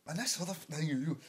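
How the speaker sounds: ordinary delivery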